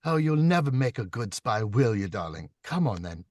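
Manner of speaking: teasing feminine character with an accent; loud, high start settling down, needling him; Zita. Shown as a demonstration. Narrator voicing Zita giving Paul a difficult time, accented and teasing. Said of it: "She gives them such a difficult time".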